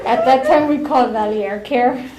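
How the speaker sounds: loud laugh into words; laughing loudly. Real, not acted. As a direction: talk loud through laughter.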